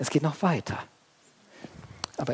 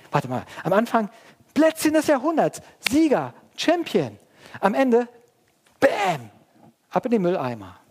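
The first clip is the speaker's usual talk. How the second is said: high, loud, punchy contrast; loud, high preaching burst; emphatic preaching; 9 semitones higher, 6 dB louder than usual. Real, not acted. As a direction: hammer the contrast from champion to bin.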